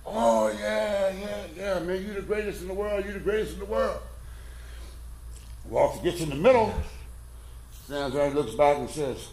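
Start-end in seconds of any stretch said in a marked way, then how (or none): 0.0-4.0 high, eager crowd gushing agreement. Acted. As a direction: play the whole cheering crowd, pitched way up. Crowd cheering the tightroper.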